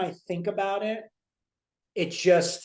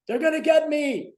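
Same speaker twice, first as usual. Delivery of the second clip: ranting; 10 semitones higher, 3 dB louder than usual. Retold or imitated. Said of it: "starts ranting and raving to Alice".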